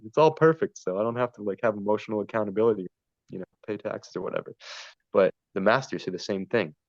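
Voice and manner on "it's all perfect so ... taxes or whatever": mocking high, anxious excuse-maker; nervous little cultist. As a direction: mimic someone dodging responsibility, pitched up and loud at first. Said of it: "nervous little cultists that want to avoid".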